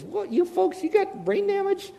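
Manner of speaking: high, incredulous jab; mocking disbelief. Preacher voicing Paul scolding Christians, high and mocking. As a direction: sneer at them like they've lost their minds.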